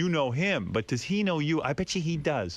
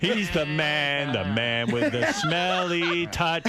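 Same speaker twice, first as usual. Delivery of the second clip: slow, dramatic hype voice; slow announcer; much slower than usual. Acted. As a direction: announce him like a legend.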